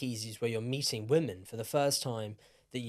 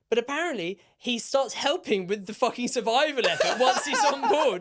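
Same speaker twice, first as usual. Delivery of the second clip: laughing; 9 semitones higher, 5 dB louder than usual. Real, not acted. Laughing at drunk survivor tale.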